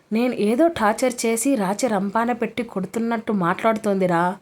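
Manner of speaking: ordinary delivery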